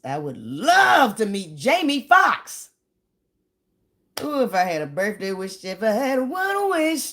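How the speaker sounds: long for it out loud like a fan; dreamy excitement. Real, not acted. A fan daydreams aloud about meeting her idol [name], dreamy and excited.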